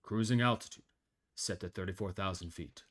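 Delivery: calm pilot reporting his own collapse; deadpan captain dying over intercom; pilot cockpit headset. Acted.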